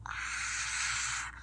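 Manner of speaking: soft unvoiced pained gasp; breathy gasp of pain. Boy stunned by hot sauce on his hand, a quiet gasp. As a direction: gasp holding back the hurt. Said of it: "he's like".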